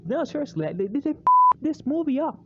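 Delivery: exasperated insistence. Real, not acted. Rant about ruined sequel.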